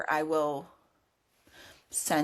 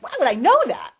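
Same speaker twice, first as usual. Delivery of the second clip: high, loud self-questioning; incredulous; 9 semitones higher, 8 dB louder than usual. Retold or imitated. Retelling her doubt about her own hunch, incredulous. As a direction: burst out in bafflement at yourself. Retold inner disbelief, second take.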